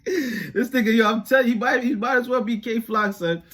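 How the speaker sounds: loud, high-pitched amused disbelief; incredulous outburst. Real, not acted. Mocking the guy posing as [name], incredulous outburst. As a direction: shout it, cracking up at the nerve of it. Laughing mockery of the impersonator.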